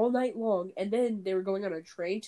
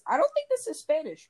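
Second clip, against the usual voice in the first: high-pitched quote of a confused student; lightly acted, higher-voiced quote; retold realization; 8 semitones higher than usual. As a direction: lift the voice high, playing the puzzled student.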